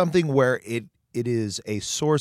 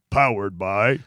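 hammed-up shout; 2 semitones lower, slower than usual. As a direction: belt out the brand name like a game-show host. Hammed-up sponsor name reveal.

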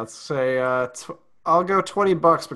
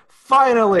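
fans cheering; 10 semitones higher, 10 dB louder than usual. Retold or imitated. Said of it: "Mavs Twitter has collectively said".

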